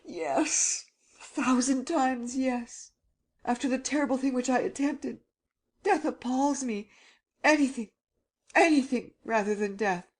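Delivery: put-on high, terrified young man pleading; frightened young man. Frightened Gérard begging.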